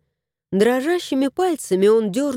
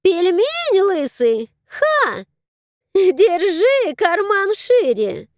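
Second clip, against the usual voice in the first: mocking crow cackle, high; hoarse laugh; 9 semitones higher, slower than usual. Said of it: "Ворона хрипло засмеялась".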